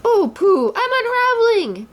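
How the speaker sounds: Piglet calls for help as he floats; crying out in fright. A narrator's reading. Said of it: "Piglet cried".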